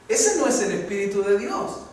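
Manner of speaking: ordinary talk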